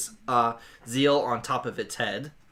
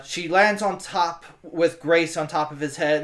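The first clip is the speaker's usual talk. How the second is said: ordinary talk, nothing marked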